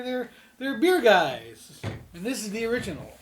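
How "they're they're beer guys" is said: slow loud stress on beer guys; slow emphatic. Real, not acted. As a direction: drag out each word.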